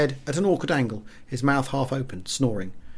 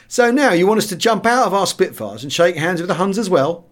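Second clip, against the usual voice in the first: scornful; 6 semitones higher, 5 dB louder than usual. A narrator's reading. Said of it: "voiced the usual scornful reaction".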